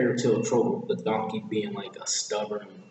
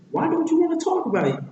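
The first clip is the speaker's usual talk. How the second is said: high, fast pestering sidekick; very high, insistent question; Donkey; 4 dB louder, faster than usual. Acted. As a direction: badger in a squeaky rush. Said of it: "And then Donkey says".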